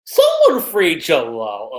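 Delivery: loud, drawn-out playful plea; loud and slow in mock despair; mock desperate plea. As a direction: beg for a rescue, half-joking.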